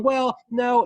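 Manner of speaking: high, loud mocking reply; a squeaky protester brushing it off; voicing protesters, hammed-up. Protesters voiced in retold clash.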